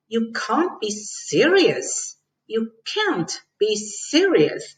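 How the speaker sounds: slow, with heavy stress on "serious"; You can't be SERIOUS, slow and emphatic; strong disagreement. Shown as a demonstration. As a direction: draw out "serious" with a swooping, emphatic tune. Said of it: "you see my tone when I'm saying serious".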